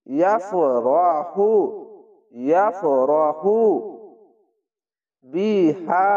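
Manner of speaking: slow ইয়াফরাহু with হু drawn out one alif; recited slowly. Shown as a demonstration. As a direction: stretch the হু one beat. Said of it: "এক আলিফ টেনে পড়বেন". (এক আলিফ টেনে ইয়াফরাহু)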